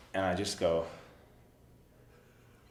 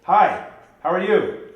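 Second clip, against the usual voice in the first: bright greeting, much higher and louder; eager fan greeting; 7 semitones higher, 10 dB louder than usual. Acted. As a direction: beam at your idol. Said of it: "I'm [name]".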